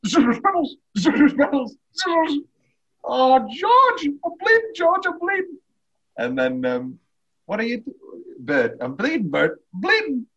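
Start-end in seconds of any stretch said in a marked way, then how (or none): 0.0-5.6 high, loud frantic film-quote voice; shrill-free hammed-up movie quote, pitched way up and loud; quoting the film scene. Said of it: "my favourite part 'cause I quote it all the time". 7.4-10.3 partly raised, hammed-up film quote; half-hearted movie quote with a high final cry; quoting the film scene. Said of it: "I quote it all the time".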